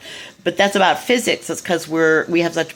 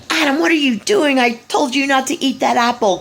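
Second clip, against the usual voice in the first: scolding father; 8 semitones higher than usual. Retold or imitated. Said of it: "storming angrily".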